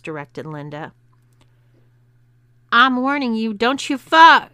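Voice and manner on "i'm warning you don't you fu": loud, high warning cut short; angry bellowing. A narrator's reading. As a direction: bellow a threat, building to a shout. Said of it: "his angry bellowing was cut off".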